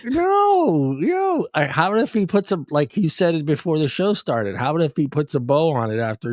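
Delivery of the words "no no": high squeaky protest. Real, not acted.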